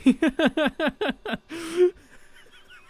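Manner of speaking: high, loud rattling laughter; real cackling laugh. Real, not acted. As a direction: burst into a long helpless cackle. Laughs after being teased in chat.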